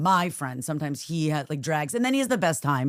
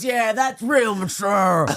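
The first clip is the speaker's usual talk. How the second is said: loud, drawn-out mocking retort; retold sarcasm; 4 semitones higher, 5 dB louder, much slower than usual. Wife imitating husband's comeback, drawn-out sarcasm. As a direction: stretch it out slowly and loudly, sarcastic.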